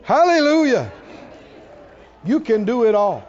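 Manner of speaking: loud, high Hallelujah; exultant praise. Real, not acted. Preacher praising God before the church, exultant. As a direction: call out praise to the congregation.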